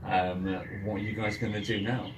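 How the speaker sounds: ordinary delivery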